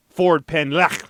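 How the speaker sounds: "Ford Penn Lech" set apart, with a guttural final ch; careful Welsh pronunciation. Shown as a demonstration. Host returning to the Welsh street name, "Ford Penn Lech" pronounced with guttural ch. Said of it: "I believe that's how they pronounce it".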